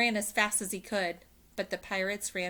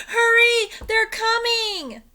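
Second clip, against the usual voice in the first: panicked shout; 14 dB louder, slower than usual. Acted. Annie calls from ladder.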